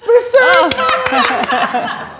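echoing an audience member's hometown, laughing; delighted echo. Real, not acted. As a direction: savour the place name with laughing delight. Repeats audience member's answer delighted.

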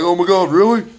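high, loud startled exclamation; retold shock. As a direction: imitate her shocked disbelief. Retold wife's shocked reaction.

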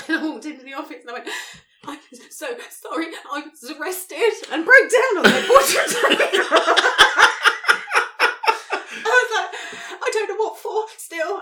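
laughing through the retelling, high and loud; helpless laughter breaking the words up; can barely get it out for laughing; laughing hard. Real, not acted.

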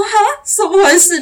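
high startled cry of alarm; startled huh. Retold or imitated. Barista startled when the customer questions her, surprised cry. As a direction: jolt up in nervous surprise. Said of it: "就心里这样子有点紧张".

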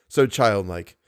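normal talking voice

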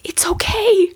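put-on high, tender young son's voice; little boy. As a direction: lightly imitate a small child forgiving his mother. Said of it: "He looked at me and he goes".

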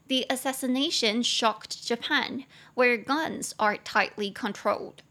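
slow, careful English model sentence; slow model reading. Shown as a demonstration. Teacher reading the model sentence aloud to students, slow and clear. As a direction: read it slowly and clearly for learners. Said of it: "那最后第五句".

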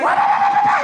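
very high, loud mock babble; teacher's babbled outburst. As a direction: shriek rapid nonsense syllables as the angry teacher. Teacher's incomprehensible French outburst.

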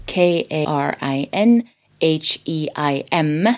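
usual speaking voice

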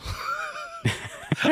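high laugh. Real, not acted. Laughs at botched spelling.

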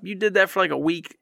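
ordinary delivery

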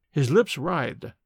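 usual speaking voice

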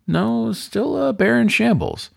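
plain reading voice

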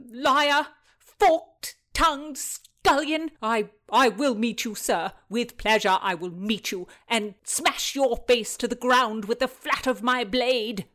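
loud, high-pitched insults and threats; furious, spluttering challenge to fight; enraged retort. A narrator's reading. Acted quarrel, enraged retort.

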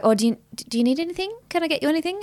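hovering host checking in; imitating fussing mum. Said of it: "one would be like".

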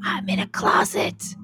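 unvoiced whisper from hiding; whispering on the phone. Real, not acted. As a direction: hush it to a whisper. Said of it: "why are you whispering".

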